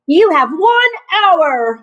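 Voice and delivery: loud, slow, emphatic announcement; exclaiming. A narrator's reading. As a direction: declare it loudly and deliberately. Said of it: "Muckle exclaimed to Santa".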